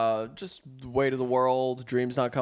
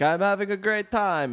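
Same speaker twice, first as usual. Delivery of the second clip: mock French tourist; 9 semitones higher than usual. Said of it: "the French guy was like".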